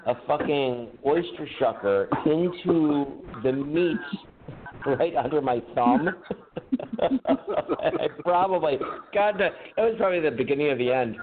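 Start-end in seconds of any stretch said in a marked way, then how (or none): none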